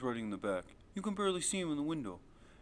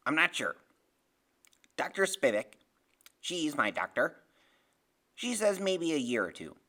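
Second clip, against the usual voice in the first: louder, deliberate character voice; Freak explaining; 5 dB louder than usual. Acted. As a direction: explain it firmly and precisely.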